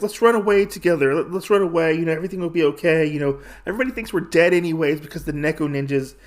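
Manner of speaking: high, hurried persuasion; urgent pleading. Retold or imitated. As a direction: rush through it, urging him to flee. Retold princess urges escape.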